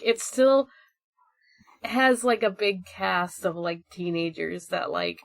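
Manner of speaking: ordinary talk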